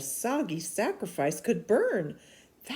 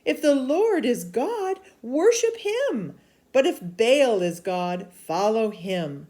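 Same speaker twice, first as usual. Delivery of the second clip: higher, louder, slower challenge; urgent prophet; 6 semitones higher, 7 dB louder than usual. Acted. Elijah calling the people to decide, urgent and loud. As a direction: press the crowd to choose.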